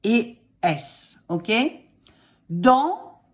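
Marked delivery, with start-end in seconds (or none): 2.5-3.2 dictating. Shown as a demonstration. Dictating slowly.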